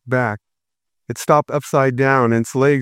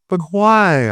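sobbed; 6 semitones higher than usual. A narrator's reading. Little girl sobbing to her brother as he leaves. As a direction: cry the question out through tears. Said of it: "I sobbed".

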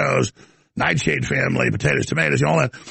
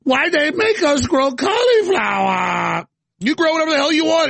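high, shouting hammed-up Chinese accent; mock Chinese accent; 8 semitones higher than usual. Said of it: "Now I'm doing a Chinese accent".

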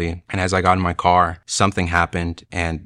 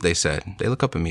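normal talking voice

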